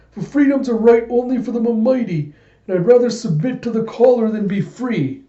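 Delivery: high, whiny coward voice; imitating a cowardly weakling pleading; nervous stammering. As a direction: stammer excuses in a high, frightened voice. Yorg justifies betrayal, stammering.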